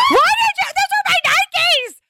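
put-on high outraged yell from the kids; shrieking kid protest; kids flipping out. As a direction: shout it like a kid whose Nikes just got tossed. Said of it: "My kids were flipping out".

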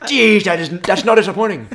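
loud, high Irish impression; Irish accent. Co-host repeating the praised impression, Irish accent. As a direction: put on an Irish accent with gusto. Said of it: "that's a pretty good Pharaoh".

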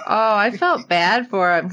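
ordinary delivery

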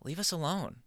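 a lightly voiced weary child; sad kids. Comedian voicing camp kids brushing off the clown, mock-glum. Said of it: "they're just sorta like".

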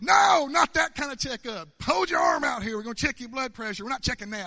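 high mock scolding, loudest at the start; imitated yell easing into insistent explaining; Vanessa screaming. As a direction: shout the correction, imitating her exasperation. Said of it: "Vanessa started screaming".

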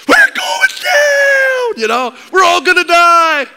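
hammed-up panicked shout, high and loud; dramatic panicked brother. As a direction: play the dramatic brother screaming they're doomed, slowly. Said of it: "He's dramatic".

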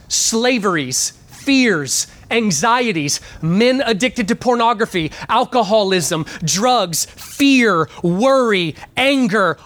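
ordinary delivery

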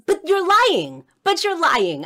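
high, loud indignant outburst; going off. Retold or imitated. Child argues with catechism teacher about miracles, going off. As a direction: act out a child's heated accusation, drawn out. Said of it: "and I just went off".

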